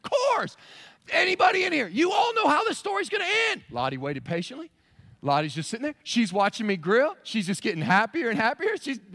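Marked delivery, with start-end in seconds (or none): 0.0-3.6 high, loud preacher shout; rising shout to the congregation. Real, not acted. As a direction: call it out to the whole room.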